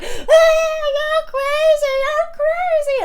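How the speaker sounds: high, slow shrill mock of a panicked friend; mocking shriek. Friend mocked screaming at the narrator over the smoothie. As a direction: squeal it out like a cartoon of her.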